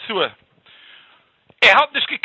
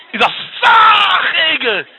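exclaiming shout; 11 semitones higher, 7 dB louder than usual. Acted. Character exclaims in story.

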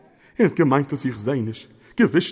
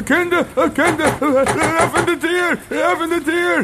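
high, laughing call through the door; calling the children; 8 semitones higher than usual. Acted. Guest outside in the storm calls the children to open the door, high and laughing. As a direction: shout cheerfully up to the kids to open up.

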